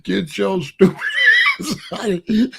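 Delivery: half-laughing mock shout to get out; loud retold brush-off with a laugh; retold yell. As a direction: bark him out the door, laughing through it. Retelling throwing someone out.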